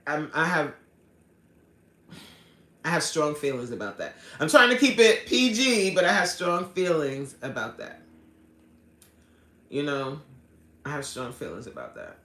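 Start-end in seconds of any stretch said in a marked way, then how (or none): 4.4-8.0 loud, higher burst that eases off; heated emphasis. Real, not acted. Vlogger holding back strong feelings on prison release, heated. 10.8-12.2 low, quiet and controlled; restrained. Real, not acted. Vlogger reining in strong feelings about prison, restrained. Restrained repeat after heated burst.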